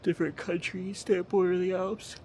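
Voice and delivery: words stretched by a yawn. Real, not acted. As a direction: yawn through the line. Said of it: "I'm sorry I keep yawning".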